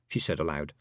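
plain reading voice

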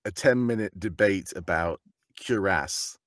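ordinary delivery

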